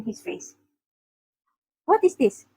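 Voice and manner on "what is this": higher, pitched-up demand. A narrator's reading. Luffy thrusting a shoe at Sanji, demanding. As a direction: demand an answer, voice raised in pitch. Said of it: "Luffy demanded".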